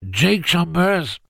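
high slow nasal voice; nasal, pinched nose. A narrator's reading. Jake answering with his nose pinched, nasal. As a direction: speak through a pinched nose. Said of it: "la voix de Jake était nasillarde".

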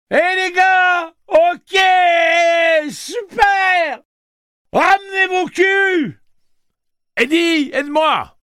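high, loud elated cheers; overjoyed high calls; joyful shouting. Acted. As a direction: yell with delight at the reunion. Susanna cheers, laughing and crying.

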